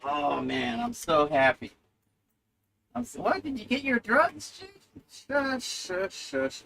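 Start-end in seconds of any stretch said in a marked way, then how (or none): 2.9-4.8 high, soft other voice; other person's voice. Acted. 5.1-6.6 very soft shushing, high. Acted.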